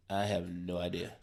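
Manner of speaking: put-on mumbled drunk answer. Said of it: "he just looks at me".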